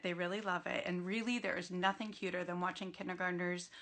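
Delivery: ordinary delivery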